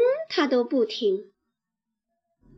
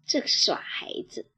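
ordinary delivery, nothing marked

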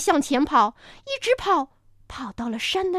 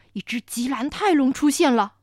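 usual speaking voice

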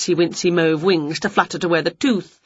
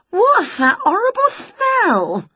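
high, slow, disgusted witch; a witch wrinkling her nose; slower than usual. A narrator's reading. Winnie complaining about the smell, slow high witch voice.

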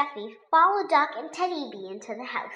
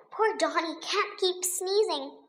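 ordinary delivery, nothing marked

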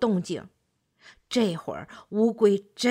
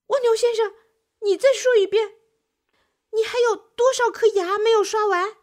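高声又响的惊问; 不敢相信地追问; 吓了一跳; 11 semitones higher, 5 dB louder, faster than usual. A narrator's reading. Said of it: "乌龟给吓了一跳". (乌龟吓了一跳, 高声追问)

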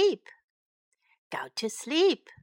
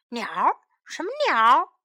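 ordinary delivery

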